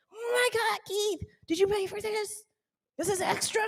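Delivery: high, soft, astonished aside; hushed amazement. Retold or imitated. Wife asking husband about cruise gifts, amazed. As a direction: ask in a high, hushed voice of disbelief.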